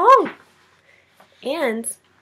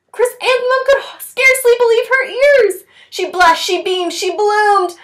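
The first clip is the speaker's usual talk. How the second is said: higher, louder, thrilled storytelling; overjoyed narration; 11 semitones higher, 6 dB louder than usual. A narrator's reading. Narrating Chrysanthemum's joy at the teacher's words, high and thrilled. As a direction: let the joy burst out, slowing on bloomed.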